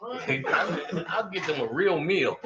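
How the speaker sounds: ordinary talk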